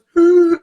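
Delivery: loud, high imitated gasp; panicked gasp. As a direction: gasp like a kid watching water rise.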